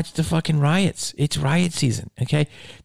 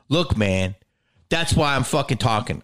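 usual speaking voice

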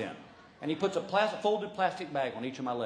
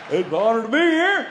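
loud, nervous gush; overeager; 6 semitones higher, 10 dB louder than usual. Retold or imitated. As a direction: blurt it out louder and higher. His nervous overeager reply.

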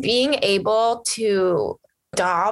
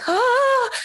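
excited squeal; 3 dB louder than usual. Retold or imitated. Email about Bullet arrives.

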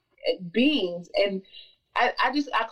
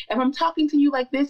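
higher, lighter demo voice; light voice without bass; 4 semitones higher, 3 dB louder than usual. Shown as a demonstration. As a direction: show the voice with no bass in it. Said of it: "When I put some bass in my voice".

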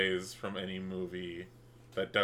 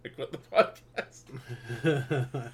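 laughing; 2 semitones higher than usual. Real, not acted. Laughing repeat of quitting.

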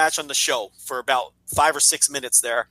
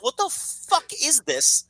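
baffled disbelief; 6 semitones higher, slower than usual. Retold or imitated. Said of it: "I'm just watching this like".

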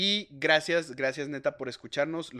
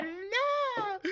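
sweet coo. Real, not acted. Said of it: "¡Ay, qué".

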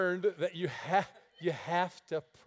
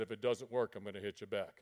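much lower, quiet warning; calm menace; low threat; 9 semitones lower, 6 dB quieter, faster than usual. Retold or imitated. Big man threatening the preacher, low and quiet. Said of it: "He said".

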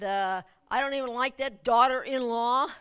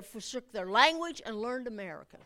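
ordinary talk, nothing marked

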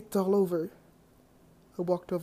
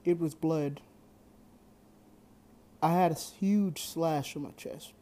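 ordinary delivery

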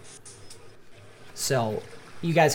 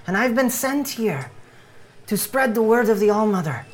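put-on higher-pitched, accented doomsday evangelist; fervent creature missionary in a raised voice; earnest missionary; 6 semitones higher than usual. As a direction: spread the word with solemn urgency. GM plays Bohan missionary.

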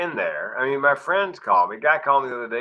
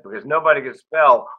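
punchline punched louder; delivering the punchline; 3 semitones higher, 8 dB louder than usual. Retold or imitated. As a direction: hit the punchline hard. Punchline retold on stage.

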